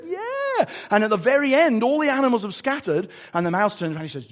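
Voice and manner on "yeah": high-pitched kiddie cheer; cheering children. Retold or imitated. Children delighted the Gruffalo is fooled, gleeful. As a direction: squeal it with delight. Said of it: "And the children are going".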